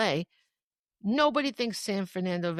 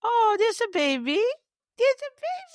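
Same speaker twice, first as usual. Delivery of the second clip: singsong greeting for an infant; 13 semitones higher, 4 dB louder than usual. Real, not acted. Host spots the baby on the show, cooing baby talk.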